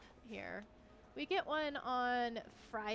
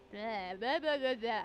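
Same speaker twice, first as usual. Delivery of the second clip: blah blah filler; 5 dB louder than usual. Pretend. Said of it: "I can't read today".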